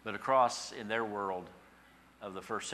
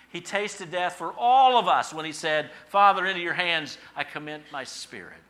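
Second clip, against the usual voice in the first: raised, ringing pulpit voice; impassioned preaching; 8 semitones higher, 11 dB louder than usual. Real, not acted. Preacher quoting Christ's last cry, impassioned. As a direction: proclaim it loudly, pitched high.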